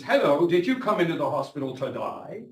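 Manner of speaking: imitating a thick Australian accent; doctor's Australian accent, done as an impression. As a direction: greet the patient in a mock Australian accent. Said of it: "his accent was not gonna be understood".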